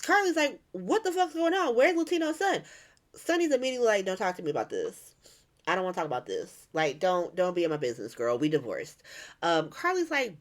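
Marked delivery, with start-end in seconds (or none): 0.7-2.6 high-pitched, exasperated questioning; alarmed demand. Retold or imitated. Carly confronting Sonny at her house, high and indignant. 4.1-9.0 low-pitched, curt refusal; dismissive brush-off. Retold or imitated. Sonny refusing to discuss it with ex-wife, low and dismissive.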